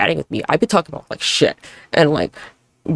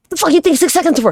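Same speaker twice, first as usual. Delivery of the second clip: higher, louder disbelieving snap; exasperated rant; 10 semitones higher, 4 dB louder than usual. Retold or imitated. Complaining about a model thinking too long, exasperated snap.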